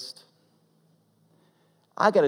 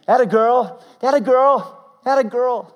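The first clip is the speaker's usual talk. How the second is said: put-on much higher and louder "atta girl"; excited cheering; 10 semitones higher, 10 dB louder than usual. Officer cheering the little girl as she breathes, loud and high. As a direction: cheer her on with everything you've got.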